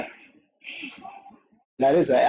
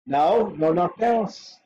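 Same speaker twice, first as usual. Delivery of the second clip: ordinary talk, nothing marked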